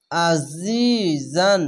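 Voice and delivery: আজিজান slow, the জ buzzing; buzzing jha. Shown as a demonstration. Teacher showing the buzzing জ sound in আজিজান. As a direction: let the জ of আজিজান buzz. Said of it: "ঝিনঝিন করে আওয়াজ করছে".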